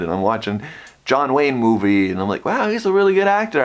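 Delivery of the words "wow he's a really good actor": high, quick burst of admiration; retold admiration. Patient watching a John Wayne movie, impressed.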